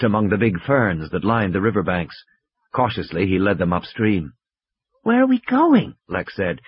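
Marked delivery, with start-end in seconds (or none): 5.0-5.9 put-on high, young girl's voice; child voice. A narrator's reading. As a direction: voice Lex as a small girl.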